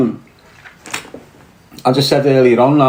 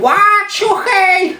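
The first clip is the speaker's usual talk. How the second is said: retold mock scream; much slower than usual. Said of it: "everyone's going".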